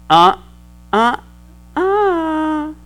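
lightly voiced mother's warning tut; a praying mama's soft disapproving uh-uh. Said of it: "sounds like mama's going".

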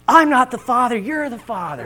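mock talk-show guest denial; lightly hammed-up daytime TV shouting match. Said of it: "those types where they're like".

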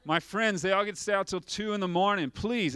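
put-on teen begging dad for a later curfew, whining; pleading teenager. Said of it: "you go to Dad and you're like".

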